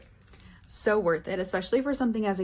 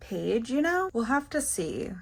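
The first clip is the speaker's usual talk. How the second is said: ordinary delivery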